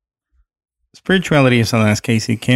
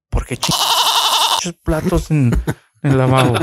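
exasperated Mexican parent; 6 semitones higher, 4 dB louder than usual. Retold or imitated. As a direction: drag out the complaint in Spanish. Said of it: "As any Mexican would do".

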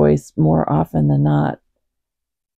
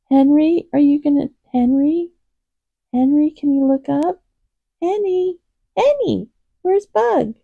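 high-pitched pet-talk to a cat; kitty voice; 9 semitones higher than usual. Real, not acted. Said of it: "Sorry for my kitty voice".

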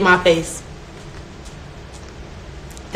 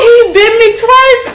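much higher, louder, slow outrage; outraged squeal; 12 semitones higher, 6 dB louder, much slower than usual. Real, not acted. Telling friends about the bite, indignant squeal. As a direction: wail it in disbelief.

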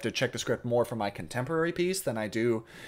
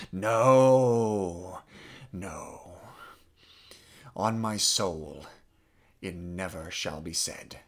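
resolute refusal; 5 semitones lower, much slower than usual. Acted. Acted refusal closing the monologue.

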